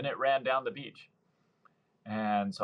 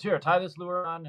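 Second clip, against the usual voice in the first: eager buddy; 8 semitones higher, 3 dB louder than usual. Retold or imitated. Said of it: "he goes".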